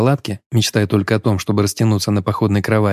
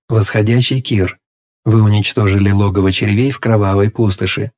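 system announcement. Acted. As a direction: read it like a game interface. Reads system message.

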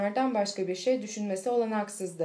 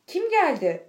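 higher, louder cry of disbelief; excited cry; 7 semitones higher, 6 dB louder than usual. A narrator's reading. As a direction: cry out the question, high and loud. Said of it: "diye haykırdı". (heyecanla haykırarak)

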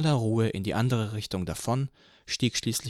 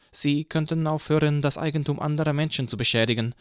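put-on higher stern young cop; young officer rebukes Eugene, higher pitched; young policeman; 6 semitones higher, 3 dB louder than usual. A narrator's reading. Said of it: "antwortete der jüngere".

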